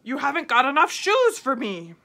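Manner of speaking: loud, slow tiger boasting with a punched four. A narrator's reading. Storyteller voicing the tiger mocking the boy, loud. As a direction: growl out the tiger's scorn. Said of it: "But the tiger said".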